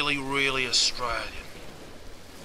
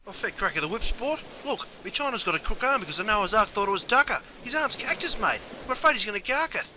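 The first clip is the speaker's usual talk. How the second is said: a much higher, frantic comic Aussie voice; high panicked character; 8 semitones higher than usual. Acted. Bloke begs for help as his mate is bitten, high and frantic. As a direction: plead for your mate like it's life or death.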